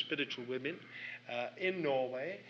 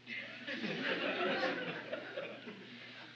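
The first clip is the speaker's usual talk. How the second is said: breathy laugh; 4 dB quieter than usual. Real, not acted. Laughs at being caught out.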